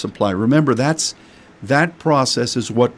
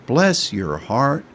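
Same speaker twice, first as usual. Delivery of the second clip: Southern bless your heart; slower than usual. Shown as a demonstration. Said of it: "That's a Southern phrase of saying".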